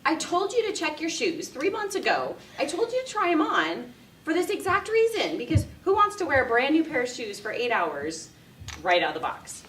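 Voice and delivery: high, loud told-you-so lecture; exasperated scolding. Retold or imitated. Bride scolding her sister-in-law over new shoes, exasperated. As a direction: scold her like she should have known. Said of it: "I want to tell her".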